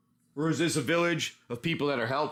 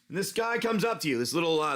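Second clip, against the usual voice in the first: ordinary talk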